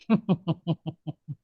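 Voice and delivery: soft laugh with lips closed; quiet shaking laugh, mouth shut. Shown as a demonstration. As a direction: chuckle through closed lips. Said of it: "Close your lips and laugh".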